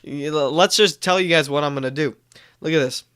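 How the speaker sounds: normal talking voice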